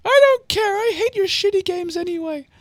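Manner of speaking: very high petulant squeak; C C's voice. Acted. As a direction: whine it in a sulky falsetto. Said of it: "I forget what C C's voice is".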